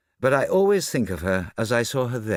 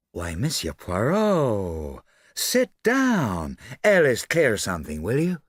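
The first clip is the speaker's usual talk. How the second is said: drawn-out, high-swooping society lady; gushing socialite; 3 semitones higher, 3 dB louder, slower than usual. Acted. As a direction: greet grandly, then order the maid about.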